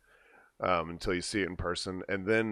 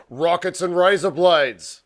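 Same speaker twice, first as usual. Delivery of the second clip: show intro; 9 semitones higher, 10 dB louder than usual. Acted. Host announces show name.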